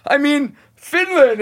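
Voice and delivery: high, loud, drawn-out cry of disbelief; hammed-up shocked yell; exasperated outburst. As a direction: throw your hands up at the word Finland. Exasperated realization retold.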